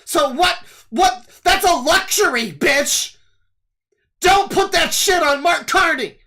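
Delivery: hammed-up furious lecture over cheese; mock nitpicking scold. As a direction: yell at them like a petty online scold. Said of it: "nitpick what you bought and be like".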